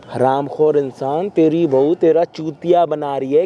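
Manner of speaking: low, deliberate scolding; stern berating. Acted. Host warns the man that he is being fooled, stern berating. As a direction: drop the voice and drive it home.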